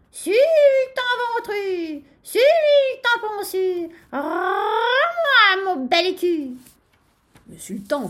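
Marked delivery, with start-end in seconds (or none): 0.1-6.5 high, drawn-out rooster crow; crowing at full throat. A narrator's reading. Rooster taunts the sultan for his coin, crowing. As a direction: crow each phrase long and loud, stretching the vowels. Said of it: "s'égosilla de nouveau".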